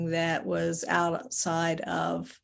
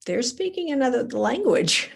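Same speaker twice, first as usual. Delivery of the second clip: higher, exclaimed disbelief; amused, emphatic amazement; incredulous; 6 semitones higher, 4 dB louder than usual. Retold or imitated. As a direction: marvel at it, pitch swooping up. Incredulous thought about angry people.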